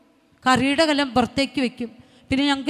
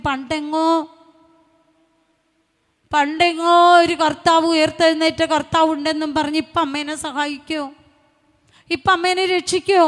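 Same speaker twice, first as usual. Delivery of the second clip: retold challenge; 4 semitones higher, 5 dB louder than usual. Son challenges praying mother's faith.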